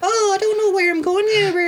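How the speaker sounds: put-on very high sing-song Irish accent; high-pitched sing-song Irish impression; sing-songy Irish girl. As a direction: impersonate a lilting Irish barmaid, way up high. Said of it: "one of them had the sing-songy".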